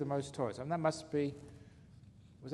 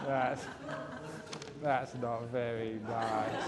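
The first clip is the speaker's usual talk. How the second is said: laughing; 3 semitones lower, 4 dB quieter, slower than usual. Real, not acted. Laughing after the punchline.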